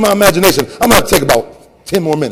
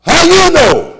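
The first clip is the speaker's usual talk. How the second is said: high, loud call from the pews; elder hollering a question at the preacher, high and loud; hollered question; 9 semitones higher, 5 dB louder, slower than usual. Retold or imitated. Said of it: "hollered out".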